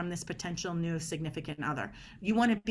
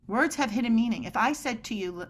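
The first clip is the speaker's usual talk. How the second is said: ordinary delivery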